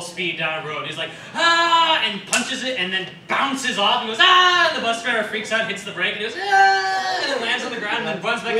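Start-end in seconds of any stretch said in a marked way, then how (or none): 1.3-2.0 high loud yell "Ah!"; a short cartoon shout; cartoon battle cry. The character punching a speeding bus, high yell. 4.2-4.7 high loud yell "Ah!"; a short cartoon shout; cartoon battle cry. The character punching a speeding bus, high yell. 6.4-7.2 high loud yell "Ah!"; a short cartoon shout; cartoon battle cry. The character punching a speeding bus, high yell.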